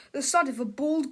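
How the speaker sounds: ordinary delivery, nothing marked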